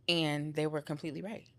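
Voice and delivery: ordinary talk, nothing marked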